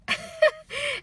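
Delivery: high burst of giggles; high laugh. Real, not acted. Teller amused by the husband's excuse, high laugh. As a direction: laugh out at the absurdity.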